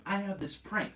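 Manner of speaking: high-pitched put-on contestant voice; mock contestant pitch.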